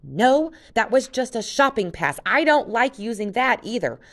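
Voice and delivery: high, loud, fierce retort. Acted. Jen corrects Luke, fierce and loud. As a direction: snap back hard and high. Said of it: "Jen turned her fierce gaze".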